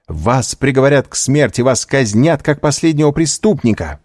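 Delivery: высокое горячее предостережение; предостереги с жаром, повысив голос; восклицание. A narrator's reading. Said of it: "воскликнула Эжени Данглар". (горячо и взволнованно)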